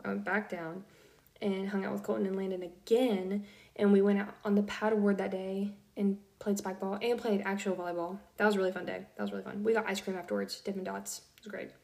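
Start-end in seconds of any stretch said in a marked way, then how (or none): none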